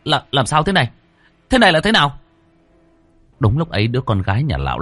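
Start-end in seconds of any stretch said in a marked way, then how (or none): 0.0-2.2 startled. A narrator's reading. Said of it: "thảng thốt".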